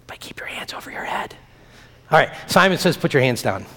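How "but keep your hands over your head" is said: put-on sly whispered aside to trip up the kids. Pastor whispering a Simon Says trick to children, sneaky whisper.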